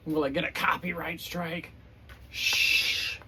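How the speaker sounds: ordinary delivery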